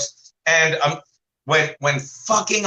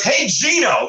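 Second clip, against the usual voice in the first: retold yell; 9 semitones higher, slower than usual. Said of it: "he yells".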